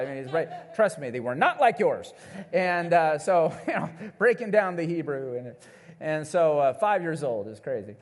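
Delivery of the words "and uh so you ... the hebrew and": ordinary delivery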